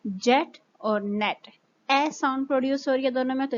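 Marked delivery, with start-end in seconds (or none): 0.1-1.5 "जेट और नेट" said slowly with a short, clipped e; teacher spells out "जेट और नेट" slowly, short e; short e words. Shown as a demonstration. Hindi teacher shows students the short e, slow and clear. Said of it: "example short sound की देख लें".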